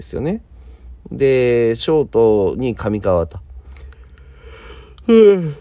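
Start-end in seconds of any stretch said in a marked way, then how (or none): 5.1-5.6 a loud yawn slipping out. Real, not acted. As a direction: yawn right through the word. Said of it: "あくびが出てしまいました". (あくびしながら)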